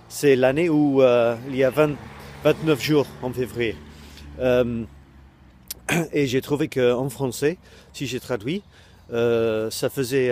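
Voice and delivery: normal talking voice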